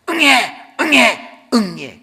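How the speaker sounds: high, loud mock infant wail; newborn baby cry. Newborn just out of the womb, acted wailing. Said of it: "울었죠?".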